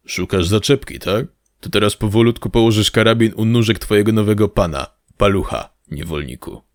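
a hint of a taunting thug, dropping away at the end; lightly voiced bullying thug. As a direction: lightly play the cocky heavy, belittling the captive. (lekko zagrany, zaczepny osiłek)

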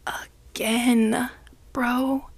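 breathy whisper of disbelief. Real, not acted. Whispering to listeners about grandma's visit. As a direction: whisper it like you can't believe it.

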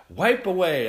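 high, loud call-out; retold hearty shout; loud go-ahead. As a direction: belt it out as a cheery invitation. Retold loud invitation to the employee.

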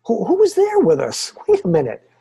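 high, loud alarmed replay of his own surprise; startled wait wait. Retold or imitated. Man replaying eerie recording, suddenly alarmed and high. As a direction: jump up in pitch like something just spooked you. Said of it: "I went".

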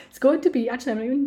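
loud, high excited burst. Real, not acted. Podcaster revealing the date to listeners, excited. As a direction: launch loudly into the news. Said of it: "I'm so excited".

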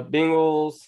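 ordinary delivery

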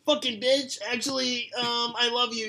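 put-on high-pitched angsty kid voice; angsty teen. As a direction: whine it high like a moody teenager. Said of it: "I'm role-playing as an angsty kid".

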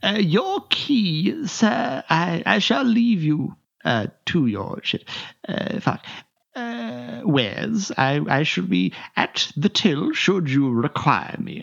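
high, drawn-out fussy teller voice; prim bank teller. Acted. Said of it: "he says".

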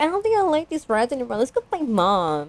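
put-on high, quick, softish kid voice; little brother's whimper. Host mimics the little brother after the ride, timid and high. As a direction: imitate a small boy backing out.

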